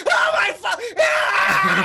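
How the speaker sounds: high, loud shriek of pain; burning scream. Acted. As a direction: scream as the lava hits. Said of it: "all of a sudden he's like".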